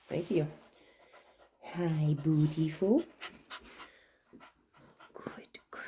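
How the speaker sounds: slow, tender hello to the dog; soft greeting. Real, not acted. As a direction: coo it quietly.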